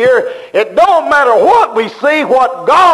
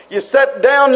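ordinary delivery

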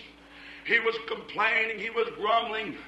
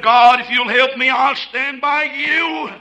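pleading cry; 3 semitones higher, 9 dB louder than usual. Acted. America pleading with God.

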